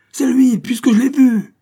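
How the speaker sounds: condemned man insisting he saw the killer; desperate sobbing cry. Acted. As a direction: scream the accusation through sobs. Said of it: "Il suppliait et sanglotait".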